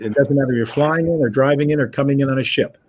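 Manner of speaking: ordinary delivery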